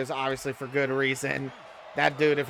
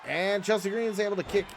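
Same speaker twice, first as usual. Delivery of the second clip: wrestling commentator; 3 semitones higher, 3 dB quieter than usual. Acted. Live wrestling game play-by-play.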